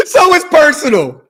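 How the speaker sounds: high, laughing slowly through the words; amused, laughing at the grudge. Real, not acted. Laughing at Stu's grudge against Vrabel, slow and high.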